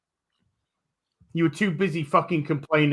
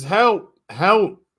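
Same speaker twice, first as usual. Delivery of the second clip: raised, higher mock cry of a woman for help; 5 semitones higher, 6 dB louder than usual. Woman in a burning building mock crying for help. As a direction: call out for help in a hammed-up woman's voice. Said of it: "a woman cries out".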